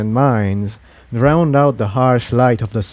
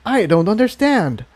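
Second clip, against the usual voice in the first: put-on higher young dragon voice, puzzled and pleading; Spike's voice; 9 semitones higher than usual. A narrator's reading. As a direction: raise the voice to play a worried young Spike. Spike replies.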